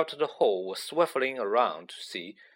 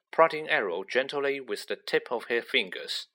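usual speaking voice

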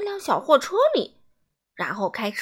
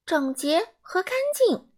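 much higher, slow and singsong; Mr. Tidy's voice; 8 semitones higher than usual. A narrator's reading. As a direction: echo his partner, pleased with himself. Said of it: "整洁先生说". (高而慢、唱歌般的整洁先生)

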